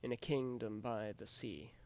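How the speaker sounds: loud, beats marked, falling at the end; scanned line. Shown as a demonstration. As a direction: read out the meter. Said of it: "one anapest followed by two iambs".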